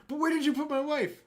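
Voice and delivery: high, loud demanding husband; hammed-up desperate demand; frantic husband.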